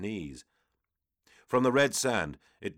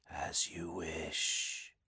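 demon whisper; 8 dB quieter, much slower than usual. Acted. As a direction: let it drift like a demon. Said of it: "Ingerthel's words drifted back".

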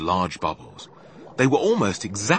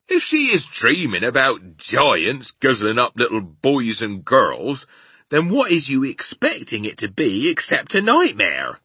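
loud, raised rustic giant voice; friendly giant, indignant; 4 semitones higher, 6 dB louder than usual. A narrator's reading. Giant argues with Sophie about nightmares, loud rustic indignation. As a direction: argue back loudly as a homely giant.

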